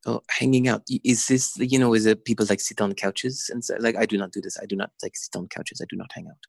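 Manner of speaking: put-on retelling in a French-accented voice. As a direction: put on a French accent, puzzled then dismissive. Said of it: "in her French accent".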